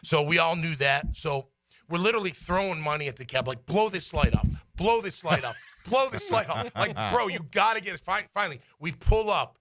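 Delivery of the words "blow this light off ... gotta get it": retold frantic urging, pitch climbing; mildly desperate plea, rising higher; urgent pleading. Players begging the cab driver to hurry, urgent pleading.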